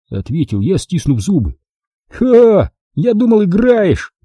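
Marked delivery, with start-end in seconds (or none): 2.1-4.1 high, scoffing taunt; mocking. A narrator's reading. Wilson taunts Jack about tennis, mocking. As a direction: scoff with an opening "Ха!". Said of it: "с издёвкой произнёс он".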